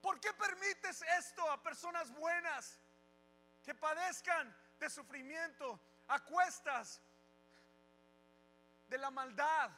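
desperate shout at God. Retold or imitated. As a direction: cry out high and loud to heaven. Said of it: "le digo a Dios".

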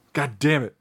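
fuller-voiced mock frustration; exasperated retold curse. Acting out annoyance at a rival impressionist, emphatic curse. Retold reaction, repeated harder.

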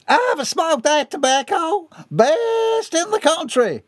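high, slow, drawn-out holler; exclaiming. A narrator's reading. Man in the crowd vouching for the tobacco, exclaiming. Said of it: "a male voice exclaimed".

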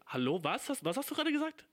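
shocked big-sibling cry; shocked outrage. Retold or imitated. Big sibling shocked at little sister's slang, high outcry. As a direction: pitch jumps high and loud in disbelief. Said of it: "Und ich so".

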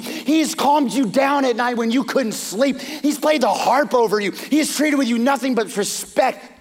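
high, loud pleading outburst; impassioned plea. Retold or imitated. Michal confronting her father Saul for David, impassioned and loud. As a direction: stand up to him and let it pour out.

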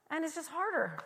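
ordinary delivery

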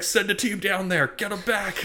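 a hammed-up boss barking orders; commanding Broyles. Imitating Broyles ordering a rescue. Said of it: "Broyles is like".

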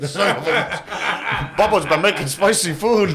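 high voice through laughter; laughing. Real, not acted. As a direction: squeeze words out while laughing.

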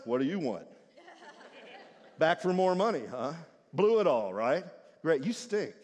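low, scornful jabs; sneering brother. Acted. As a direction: put him down with contempt. Elder brother sneers at prodigal.

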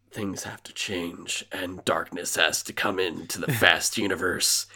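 low, soft, ominous trailer narrator; movie trailer voice. Pretend. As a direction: intone it like a dark movie trailer.

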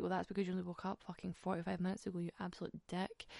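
fast, soft under-the-breath insult; muttered inner monologue. Retold or imitated. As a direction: say it to yourself quickly and quietly. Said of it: "that was my inner monologue".

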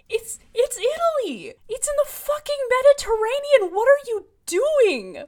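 high, loud, incredulous outburst; exasperated rant. Real, not acted. Host rants at the author's outdated racism, loudly exasperated. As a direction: climb into a loud, high-pitched protest.

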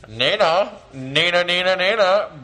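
loud sing-song call into the mic; calling out to Nina. Acted. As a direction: call her name out like a radio host. Calls Nina loudly on air.

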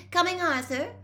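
high, loud call to another room. A narrator's reading. Mum calling to her husband in the kitchen, high and loud. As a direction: call out to him from upstairs. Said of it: "called Missus Weasley".

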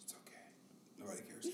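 hushed soothing whisper. Real, not acted. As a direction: whisper to quiet him down. Hushing kid.

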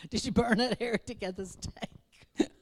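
laughs through the words; giggling at his own joke; laughing. Real, not acted.

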